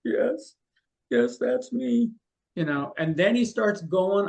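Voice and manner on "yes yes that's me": high, overplayed eager cry of recognition; mock tearful client. Tearful fake sitter at a psychic show, hammed-up and high. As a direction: ham up the grieving sitter, pitched way up.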